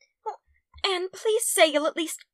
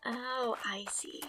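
whispered; 6 semitones lower, 14 dB quieter, slower than usual. Acted. Diana realizes, softly.

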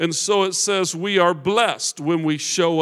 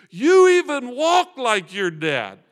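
higher, louder retold call; calling after someone; 7 semitones higher, 8 dB louder, slower than usual. Elder calls after young man walking away, calling out. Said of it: "he calls after me and goes".

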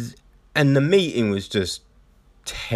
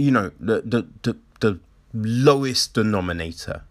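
ordinary delivery

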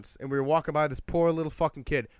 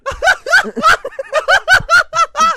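long, loud, high-pitched cackle impression; imitated friend's laugh; 15 dB louder than usual. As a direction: mimic a huge staccato laughing fit. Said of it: "when he laughs, he goes".

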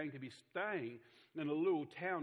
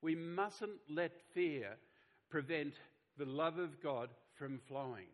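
slow call to repeat; slower than usual. Shown as a demonstration. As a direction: spell it out slowly so they can say it back. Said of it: "Can you all say that after me?".

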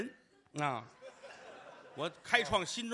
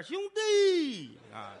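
slow, long, high call of 兄弟啊; calling brother at the top of the voice; drawn-out calling; 8 semitones higher, 4 dB louder, much slower than usual. Acted. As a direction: stretch it out like hawking a call. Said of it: "非得叫号".

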